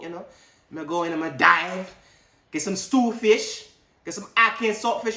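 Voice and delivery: relaxed island Jamaican patois, hammed-up; Jamaican accent. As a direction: Bond as a laid-back Jamaican yard man. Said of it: "Bond became a Jamaican".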